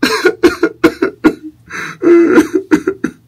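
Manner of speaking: very high sobbing falsetto; hammed-up tears of joy; mock happy crying. Dad fake-cries with joy.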